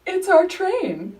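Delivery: high, slow drawn-out mock voice; mimicking the man on the platform; imitating the stranger. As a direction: stretch it out like an odd stranger. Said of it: "and he goes".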